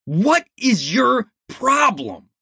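high, slow demand. Acted. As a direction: cut in with authority. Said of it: "Kahane demanded".

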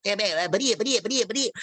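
stuttering cartoon pig voice; stammering Porky Pig impression. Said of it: "You want Porky Pig?".

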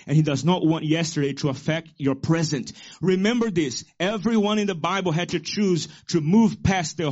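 loud, rising preacher cadence; full-force preaching. Acted. Pastor preaching to congregation, fiery sermon voice. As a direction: proclaim it to the church.

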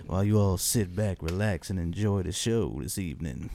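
low smooth late-night host. Acted. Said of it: "why are you so smooth".

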